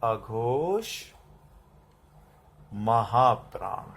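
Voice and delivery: drawn-out dictation, term by term; slow dictation. Shown as a demonstration. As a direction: spell it out slowly for pupils writing. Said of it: "फिर लिखो". (लिखवाते हुए धीमे-धीमे बोलना)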